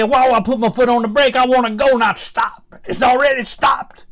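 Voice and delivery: loud, high, near shouting; indignant old man hollering at the car dealer; indignant retort. Retold or imitated. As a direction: yell back at the dealer in disbelief.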